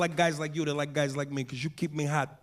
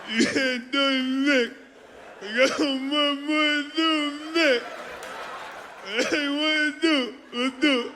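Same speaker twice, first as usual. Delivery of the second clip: mock Stallone crying; 9 semitones higher, much slower than usual. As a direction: wail it like a blubbering Rocky. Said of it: "Stallone starts crying".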